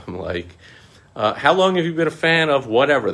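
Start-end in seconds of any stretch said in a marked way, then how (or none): 1.3-3.1 high, loud mock-eager questioning. Teasing the scammer, high and loud mock eagerness. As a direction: pepper them with fake interest.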